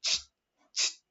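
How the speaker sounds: short sharp "ch" like a fuse catching; spark sound. Shown as a demonstration. Teacher repeats the spark for students, imitated hiss. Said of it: "la chispa hacía más o menos el ch".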